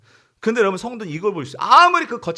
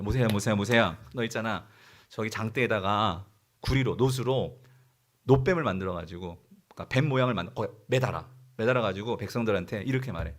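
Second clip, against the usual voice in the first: low, quieter voice of instruction; God instructing Moses; 8 semitones lower, 4 dB quieter than usual. Acted. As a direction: give calm, low-pitched orders as God to Moses. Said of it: "모세에게 말하죠". (낮고 차분하게 모세에게 지시하는 하나님 목소리)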